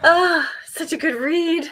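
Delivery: delighted gush. Real, not acted. Gushing over a book she loves.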